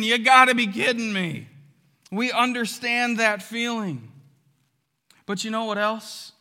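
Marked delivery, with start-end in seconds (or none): 0.0-1.5 loud, higher mock fan yell; fans screaming. Buckeye fans screaming at an upset loss, loud mock yell. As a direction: bellow it like a stadium of upset fans. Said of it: "All of Buckeye Nation is screaming".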